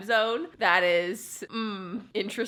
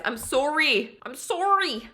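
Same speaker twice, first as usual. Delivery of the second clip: high Canadian "soh-ree", rounded O; Canadian sorry; 6 semitones higher, 4 dB louder, slower than usual. Shown as a demonstration. Vlogger showing off her sorry, exaggerated Canadian "soh-ree". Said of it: "she's so Canadian".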